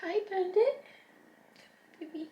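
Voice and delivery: high-pitched tender greeting; cooing to pet. Real, not acted. Owner greeting her new cat Bandit, cooing.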